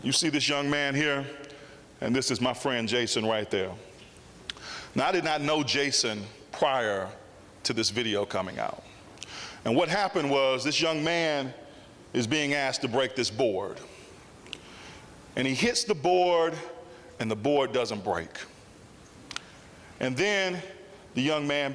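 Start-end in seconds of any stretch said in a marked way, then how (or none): none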